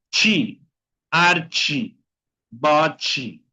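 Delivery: "chi, archi, bochi" ending in chi; final TE as chi. Shown as a demonstration. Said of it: "T más E al final de la palabra". ("chi, archi, bochi" con TE final como chi)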